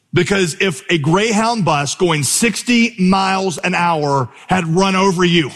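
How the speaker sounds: ordinary talk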